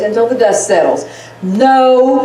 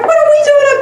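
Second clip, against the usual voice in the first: indignant; 14 semitones higher, much faster than usual. Real, not acted. Mocking absent men.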